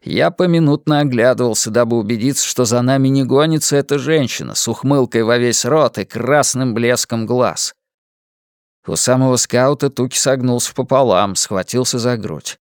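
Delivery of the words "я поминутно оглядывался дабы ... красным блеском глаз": ordinary delivery, nothing marked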